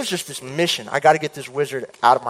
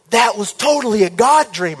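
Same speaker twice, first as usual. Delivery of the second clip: higher, louder thrilled exclaim; elated; 7 semitones higher, 5 dB louder than usual. Retold or imitated. Waking from the dream, retelling elated exclaim. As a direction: burst out in delight.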